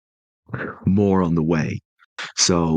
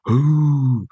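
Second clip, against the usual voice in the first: a much higher, drawn-out onlookers' ooh; crowd ooh; 9 semitones higher, 4 dB louder than usual. Retold or imitated. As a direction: voice the whole canteen reacting. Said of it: "and there's another".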